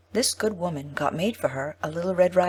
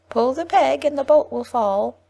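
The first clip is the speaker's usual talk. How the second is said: put-on high, louder old woman's call; grandmother crying out; 9 semitones higher, 5 dB louder than usual. Sick grandmother calling to the visitor, high and loud. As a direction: call out from the bed to the door. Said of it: "cried out".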